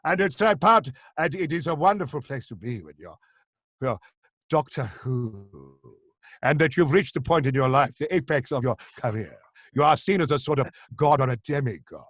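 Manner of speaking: grandiose, measured actor's oration; Tom Baker. Acted. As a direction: impersonate a star revelling in being worshipped. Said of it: "have you done yours to Tom Baker".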